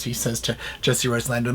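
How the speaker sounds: ordinary talk, nothing marked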